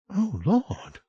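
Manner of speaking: nervous at the gate; little quaver. A narrator's reading. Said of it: "a little quaver in her voice".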